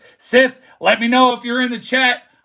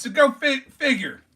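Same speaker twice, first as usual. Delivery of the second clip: ordinary talk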